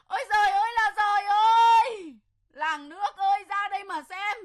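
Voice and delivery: loud lamenting wail to the neighbours; wailing outcry. Acted. Wife crying out to the village after being hit, wailing.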